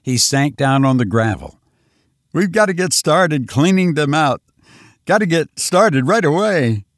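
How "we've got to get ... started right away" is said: higher and louder, pressing hard; urgent plea. Acted. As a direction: push it like there's no time left. Lois urges cleaning them out.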